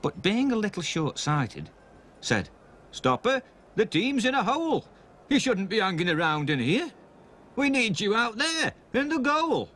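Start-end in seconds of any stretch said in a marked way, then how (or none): 2.9-9.7 higher, anxious trainer voice; trainer Reg Barber. Acted. Reg Barber urging the boy to play in goal, anxious. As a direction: plead with him to get out there, higher. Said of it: "said Reg Barber".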